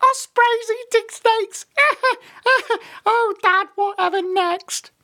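very high, slow, squeaky giggling voice; loud, squeaky giggling fit; chuckling tiny chick. A narrator's reading. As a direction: giggle through it like a baby bird. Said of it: "chuckled the tiniest chick".

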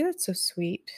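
quiet, private reaction; soft aside. Real, not acted. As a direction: murmur it to yourself, touched. Reacts softly to chat.

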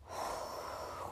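long, very soft airy breath; deep breath. Shown as a demonstration. Host leading another calming breath for good measure, deep breath. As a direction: breathe slowly and audibly to settle down. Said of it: "let's just do another one".

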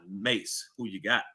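ordinary talk

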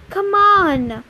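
insistent, drawn-out "come on"; urging. A narrator's reading. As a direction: hurry a friend along. Grover urging friends to leave.

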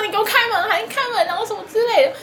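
high, fast mock yell at the bathroom door; hammed-up jealous boyfriend shouting. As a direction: imitate him banging and demanding. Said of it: "说".

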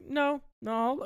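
very high imitated denial; girl covering up, retold. Retelling a daughter fobbing off her dad, high mock denial. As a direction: squeak it lightly as the girl.

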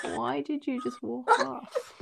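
imitating an exasperated question, stretched out; mock exasperation. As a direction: ask it slowly, in comic disbelief. Retold exasperated question after fake delight.

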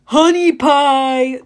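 slow, loud booming Cyclops call; loud slow monster bellow. A narrator's reading. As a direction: bellow it like a hungry giant. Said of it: "the monster bellowed".

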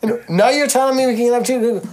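high-pitched, incredulous protest; mock outrage. Acted argument with the tour promoter.

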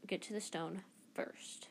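whisper. Acted. Harry hushed plan.

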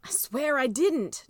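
protesting brother. Acted. Jess protests innocence.

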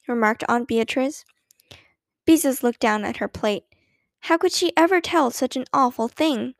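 ordinary delivery, nothing marked

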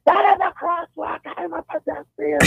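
imitating an irate woman, high and muffled; mock high-pitched outburst from under a mask. Angry pedestrian to driver through her mask, muffled high yell. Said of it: "I could hear it under the mask".

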